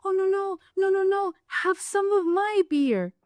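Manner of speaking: cooing comforters. Retold or imitated. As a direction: coo like comforting a child. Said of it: "everyone was like".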